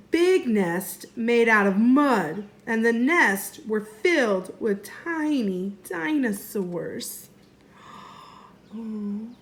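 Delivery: ordinary delivery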